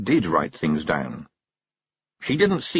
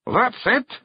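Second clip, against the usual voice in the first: high Scots question; surprised it's over; puzzled Feegle. Acted. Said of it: "he asked".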